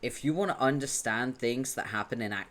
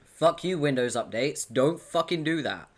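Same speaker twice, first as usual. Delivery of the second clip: angry outburst; 5 semitones higher, 8 dB louder than usual. Real, not acted. Cursing at Windows updates.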